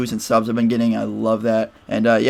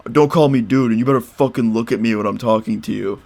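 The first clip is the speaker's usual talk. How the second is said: put-on voice of an angry boyfriend; mimicked threat. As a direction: play the bully's warning, lightly. Boyfriend threatening, acted quarrel.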